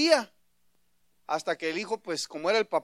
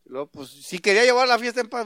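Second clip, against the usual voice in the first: ordinary talk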